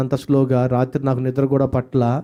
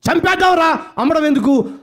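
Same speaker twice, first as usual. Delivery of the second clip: high voiced brother; 3 dB louder than usual. Brother's voiced suggestion quoted.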